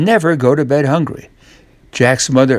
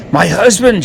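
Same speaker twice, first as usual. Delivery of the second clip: higher alarmed cry; shout; 7 semitones higher, 4 dB louder than usual. Acted. Woman shouting a warning to Jack. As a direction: warn him in a panic. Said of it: "shouts the woman".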